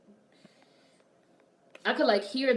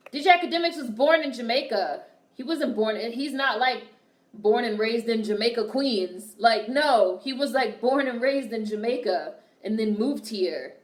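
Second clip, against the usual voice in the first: raised, louder, insisting over and over; heated correction; 3 semitones higher, 6 dB louder than usual. Real, not acted. Correcting a chat viewer's claim about his birthplace, fired up and insistent. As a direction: correct them hard, with no room for doubt.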